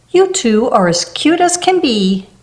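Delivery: ordinary delivery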